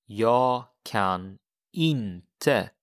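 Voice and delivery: "jag kan inte" drawn out very slowly; very slow model. Shown as a demonstration. Teacher slowly modelling the answer. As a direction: stretch out each word. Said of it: "So how would you say this?".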